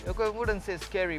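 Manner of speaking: usual speaking voice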